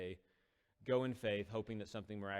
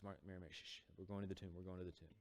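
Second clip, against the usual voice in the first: softer, acted shushing; gentle hushing; 8 dB quieter, faster than usual. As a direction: hush an upset friend quietly. Jesus hushing the upset sister.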